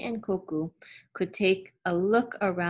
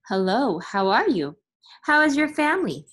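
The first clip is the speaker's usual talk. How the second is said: bright, louder calls of hello; cheerful greeting; 4 semitones higher, 7 dB louder than usual. A narrator's reading. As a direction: call out greetings warmly to neighbors. Villagers greeting on market path.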